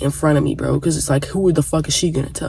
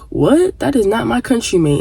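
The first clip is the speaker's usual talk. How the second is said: indignant disbelief; 7 semitones higher than usual. Retold or imitated. Friend indignantly denies the taunt.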